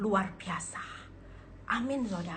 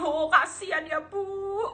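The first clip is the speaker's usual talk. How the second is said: high, cooing fake sympathy; hammed-up pitying counselor, rising high at the end; mock pity; 5 semitones higher, slower than usual. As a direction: pretend to feel terribly sorry for her. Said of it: "Coba kalau saya dengar dia nangisnya".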